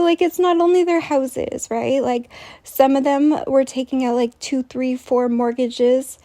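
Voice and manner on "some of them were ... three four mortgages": ordinary talk, nothing marked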